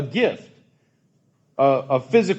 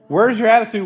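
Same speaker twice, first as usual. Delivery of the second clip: ordinary delivery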